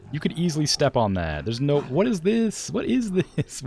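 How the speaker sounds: loud, high exasperated outburst. Real, not acted. Frustrated at pathing.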